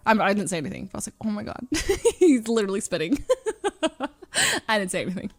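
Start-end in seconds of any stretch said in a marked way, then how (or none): 1.2-5.4 breaking into laughter mid-sentence; laughing. Real, not acted. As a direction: giggle through the punchline. Laughing while retelling intrusive thought.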